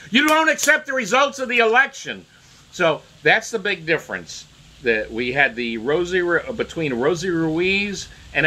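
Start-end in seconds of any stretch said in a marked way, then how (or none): 0.1-2.2 mock angry accusation. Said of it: "they get angry".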